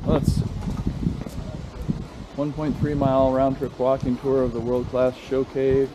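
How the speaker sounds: ordinary talk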